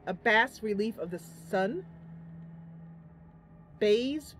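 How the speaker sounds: ordinary talk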